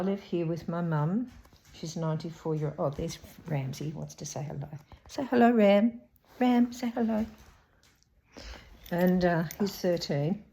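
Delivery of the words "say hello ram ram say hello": higher, louder sing-song coaxing; talking to a dog. Real, not acted. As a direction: coax the dog, pitched up. Coaxing dog to greet camera.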